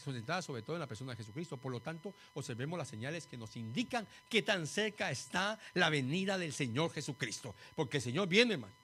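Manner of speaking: ordinary delivery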